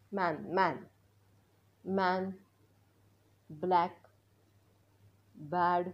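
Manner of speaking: flat a in मॅन and बॅट; teacher models the short a. Shown as a demonstration. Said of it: "माझ्या मागे म्हणायचं". (ॲ चा उच्चार)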